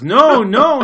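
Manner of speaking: high, loud mock clerk insisting; shrill-free but loud, impatient no. Clerk waving off a tourist, loud mock insistence. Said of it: "she goes".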